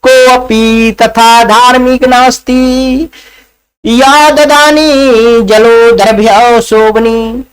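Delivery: slow, unhurried chant; sleepy Sanskrit verse recited slowly; slow lazy recital. Acted. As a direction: drag out each word lazily. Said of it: "कहता है".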